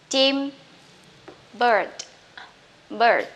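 ordinary talk

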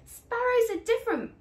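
音调高、声音大，冲着远去的背影喊; 隔着距离大声叫住儿子; calling after. Acted. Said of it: "Papa called after him".

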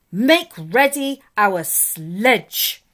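very slow, loud royal command; queen barking at the dwarf; ordering. Acted. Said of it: "she ordered".

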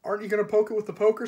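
fast, high, loud question; eager child. Acted. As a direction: ask in a rush of excitement. Eager child egging Susan on.

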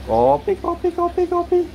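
very high, rapid-fire repeated cry; coffee seller's call. Shown as a demonstration. Owner mimics a coffee vendor for bird, very high. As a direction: pitch it way up like a street hawker.

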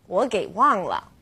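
ordinary talk

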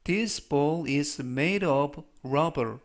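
slow, measured English for learners; slow model reading. Shown as a demonstration. Teacher reading an English example for learners, slow model reading. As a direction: read it out slowly as a pronunciation model.

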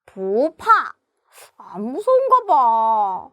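low, grumbling tiger voice; miffed tiger. Acted. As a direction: mull it over, put out and puzzled. Tiger grumbles that baby is unafraid. (투덜대는 낮은 호랑이 목소리)

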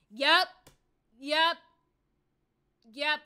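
short loud angry yeps; fed-up streamer snapping yep. Real, not acted. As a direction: bark it out in frustration. Streamer furious at follow count.

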